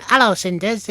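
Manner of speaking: high, loud Lancashire panto voice. Shown as a demonstration. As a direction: pipe up like a Lancashire comic. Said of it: "it was almost like [name]-esque".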